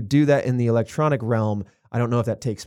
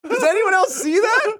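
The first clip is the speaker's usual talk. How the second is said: freaked out; 3 dB louder than usual. Pretend. Moviegoer freaking out.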